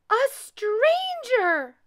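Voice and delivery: high, loud, drawn-out squeal; long high baby bear outcry; little bear squeal. A narrator's reading. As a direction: squeal it slowly as a startled cub. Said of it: "squealed Little Honey".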